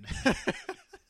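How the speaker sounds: high loud burst of laughter; shrill laugh. Real, not acted. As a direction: cackle loudly.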